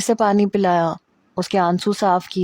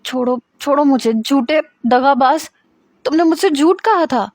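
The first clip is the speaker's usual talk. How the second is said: higher, pleading accusation; angry protest; 6 semitones higher than usual. Acted. Hira accusing Armaghan of lying, high and angry. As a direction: push him away, calling him a liar.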